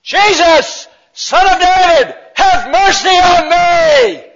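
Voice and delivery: full-out high-pitched cry for mercy; drawn-out, very high, loud plea; loud shout. Acted. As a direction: shout it out loudly across a crowd. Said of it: "he shouted out in a loud voice".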